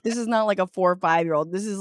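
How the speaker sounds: ordinary talk, nothing marked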